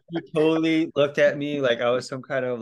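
usual speaking voice

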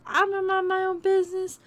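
singsong brush-off; mock sing-song. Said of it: "I'm just like".

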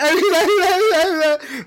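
high-pitched imitated sobbing wail; mock bawling. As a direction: imitate two people blubbering uncontrollably. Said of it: "then I start bawling".

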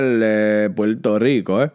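Puerto Rican accent. Shown as a demonstration. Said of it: "o puedo hablar".

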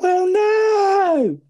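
very high, loud squealing cry; Rodney squeals out a denial to Cassie; squeaky high pitched. Retold or imitated. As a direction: yelp it right up in panic. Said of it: "he's like".

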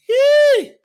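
very high, loud joyful yelp after a laugh; laugh, then shriek Yee for joy; laughing praise yelp. Real, not acted. Preacher overjoyed telling the church God watches them, a laughing yelp.

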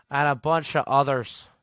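a playful Scottish accent on "And a bunch of others". Shown as a demonstration. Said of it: "I love that accent".

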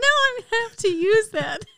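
laughing through the words; cracking up mid-sentence. Real, not acted. Laughing at hedgehog joke.

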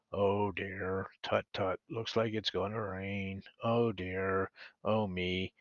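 low, soft, gloomy donkey voice; Eeyore. Acted. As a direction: sigh it out glumly, soft and low. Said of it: "Eeyore the donkey".

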